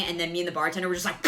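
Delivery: ordinary talk, nothing marked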